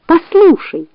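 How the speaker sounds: high, urgent fox voice; fox pleading. A narrator's reading. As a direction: shake him awake, voice up high. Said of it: "громко кричать".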